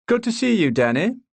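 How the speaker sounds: put-on loud, high booming welcome to an old colleague; a brash barrister greeting "Danny" with a rising, high call; hearty greeting. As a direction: clap him on the back with your voice, Danny rising.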